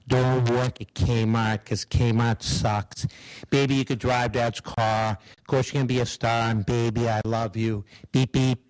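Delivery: low, slow, monotone Rain Man rapping; Rain Man impression. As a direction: drone it out flat and low like Rain Man doing a tribute. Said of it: "Rain Man does a tribute to the [name]".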